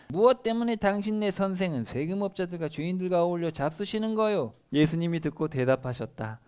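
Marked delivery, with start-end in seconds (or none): 0.1-4.5 high-pitched, indignant demand; accusing challenge. A narrator's reading. Pharisees challenging Jesus's disciples, accusing and high. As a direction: confront them with a sharply raised question. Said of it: "예수님의 제자들에게 따져댔다".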